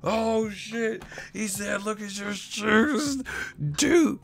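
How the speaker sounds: high, slow, squealing fan voice; shrieking fake excitement over shoes; mock hyped fan. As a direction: ham up the gushing fan, stretching each word. Mocking hyped fan, shouting.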